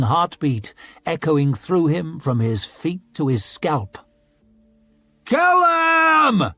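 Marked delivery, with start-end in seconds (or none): 5.2-6.5 high, loud roar over a shield. A narrator's reading. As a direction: roar it at the fallen foe. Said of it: "he heard Glaward roaring over his shield".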